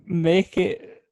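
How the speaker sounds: normal talking voice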